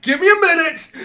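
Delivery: high, loud eager shout; excited call. Retold or imitated. Friend imitating Laura's eager rush to her movies, excited shout. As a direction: mimic her rushing off, thrilled.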